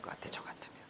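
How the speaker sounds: quick unvoiced whisper; whispered aside. Real, not acted. Preacher confiding his guess to the congregation, whisper.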